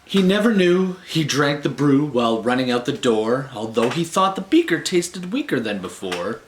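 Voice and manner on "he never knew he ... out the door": ordinary delivery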